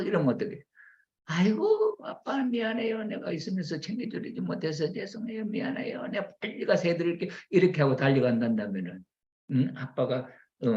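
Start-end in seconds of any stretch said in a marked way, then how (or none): 1.3-7.3 high-pitched, fussing wifely apology; eager apology. Acted. Wife to unfed husband, promising to hurry home, apologetic.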